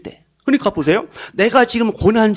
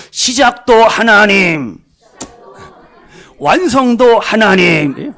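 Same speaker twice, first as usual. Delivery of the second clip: loud, slow, with all your strength; shouted declaration; 5 dB louder, slower than usual. Shown as a demonstration. Preacher urging congregation to shout louder, full-force proclamation. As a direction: proclaim it louder with everything you have. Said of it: "더 크게 선포하고 더 크게 외쳐야 됩니다".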